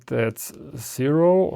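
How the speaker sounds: ordinary delivery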